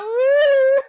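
high loud mock cheer through laughter; imitating a woo. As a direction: copy her whoop while cracking up. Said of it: "She's just like".

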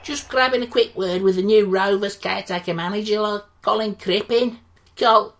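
loud, brash local radio reporter; loud cheery Yorkshire radio interviewer; sports interviewer. Acted. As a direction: gush at the manager like a matey sports hack.

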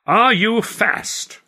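high, loud, very slow question; showman clown. A narrator's reading. Clown tests the bowler before the crowd, loudly drawn out. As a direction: stretch each word out for the audience.

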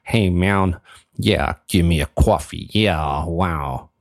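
exaggerated American twang with stretched vowels; mock American drawl; put-on American accent. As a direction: order like a hammed-up American. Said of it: "I'm getting good at this American accent".

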